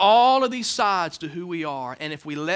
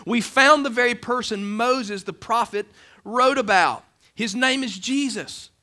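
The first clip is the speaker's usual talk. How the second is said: excited news; 6 semitones higher, 5 dB louder than usual. Acted. Philip excited about finding Jesus.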